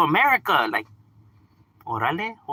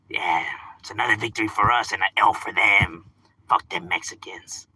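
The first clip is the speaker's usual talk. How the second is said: mock gloating whisper; 11 semitones lower than usual. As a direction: whisper it low like a smug secret. Mocking supporters' private gloating.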